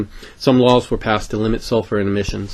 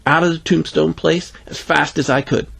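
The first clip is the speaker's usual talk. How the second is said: plain reading voice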